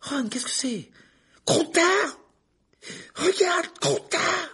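high, loud furious shout; furious outburst. A narrator's reading. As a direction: rage at her, waving the sheet. Ron brandishing the bloody sheet.

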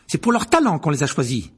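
put-on indignant girl's comeback; sharp retort. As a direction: drive the point home curtly. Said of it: "dit sèchement Hermione".

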